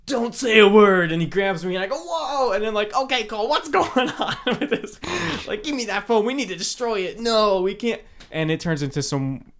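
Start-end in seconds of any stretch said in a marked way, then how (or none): 0.0-1.1 slow, louder, dramatic line; dramatic warning. Retold or imitated. Retold dramatic film scene. 2.0-8.0 panicked. Retold or imitated. Retells his own acted panic.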